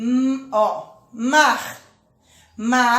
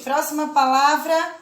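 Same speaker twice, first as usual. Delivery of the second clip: normal talking voice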